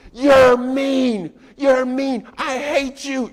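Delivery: put-on high, loud child's wail; screaming child. Toddler yelling at mom in the store, high loud shriek.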